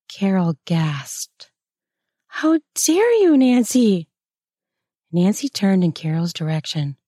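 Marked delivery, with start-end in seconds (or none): 2.3-4.1 much higher and louder, shocked; outraged cry. A narrator's reading. Apprentice outraged at her mentor, a shocked high cry. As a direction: fling it out in disbelief. Carol gasps, outraged at Nancy.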